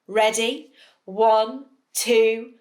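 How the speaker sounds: ordinary delivery, nothing marked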